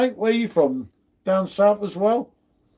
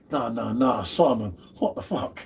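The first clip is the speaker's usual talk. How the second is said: mock disbelief; 8 semitones lower, 5 dB quieter than usual. Acting out a baffled viewer's reaction.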